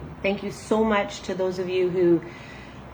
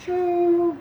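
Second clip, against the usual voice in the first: put-on nighttime old lady voice; 10 semitones higher than usual. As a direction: play a sleepy old granny reading at night. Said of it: "let's do the nighttime voice".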